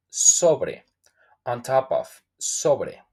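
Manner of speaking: usual speaking voice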